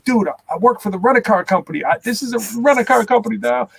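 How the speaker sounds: indignant retold objection; retold protest.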